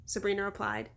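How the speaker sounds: ordinary delivery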